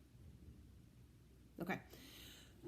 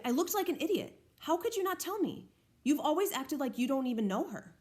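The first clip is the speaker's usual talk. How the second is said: higher, hurt and annoyed complaint; 6 semitones higher than usual. Acted. Justin confronting Olivia about Miranda, higher and annoyed. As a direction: let the annoyance climb in pitch. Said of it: "I'm really annoyed at her".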